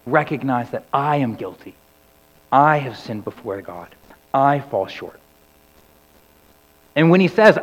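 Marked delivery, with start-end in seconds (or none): none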